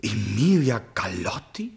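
slow breathless whisper of her name; awed whisper. Acted. Prince whispers the beloved's name before her portrait.

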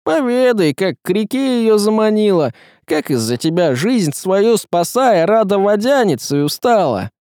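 high, forceful reproach; stern denunciation. A narrator's reading. Woman demanding the tsaritsa confess before all, stern. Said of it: "сурово сказала Ярогнева".

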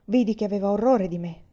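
ordinary delivery

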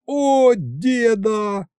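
high, drawn-out yell. A narrator's reading. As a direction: cry out in sudden inspiration, stretched long. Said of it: "завопил я так". (вопль, высоко и протяжно)

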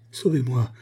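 calling for rescue, urgent but held back; frightened plea. A narrator's reading. Woman hanging over the torrent, first plea for help. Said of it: "s'écria-t-elle".

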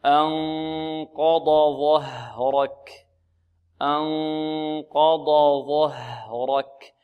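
very slow "أنقض ظهرك", every heavy letter held distinct; careful tajweed demonstration. Shown as a demonstration. Teacher modelling the verse for students, slow careful recitation. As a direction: draw out "أنقض ظهرك" with full, separate ض and ظ. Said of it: "لا نقول ظه".

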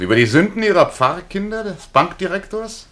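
high, loud sarcastic taunt; mocking jab. Sneering at the priest's parishioners, pitched high and loud. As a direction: needle him with mock surprise.

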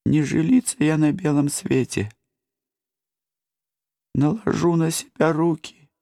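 high sobbing lament; tragic heroine sorrow. A narrator's reading. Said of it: "она рыдала всё громче".

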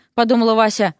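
ordinary delivery

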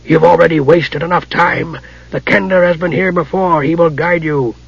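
irritable dry rasp. Acted. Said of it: "a dry, rasping voice demanded irritably".

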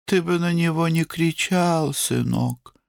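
high, plaintive motherly voice; pleading mother. A narrator's reading. Mother asking her son not to shout, pleading.

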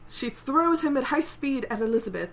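ordinary delivery

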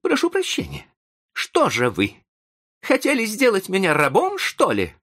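high-pitched indignant outburst; bursting into anger. A narrator's reading. Young man feels reproached as ungrateful, bursting out angrily. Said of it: "он разразился гневом".

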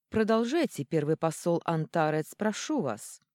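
ordinary delivery, nothing marked

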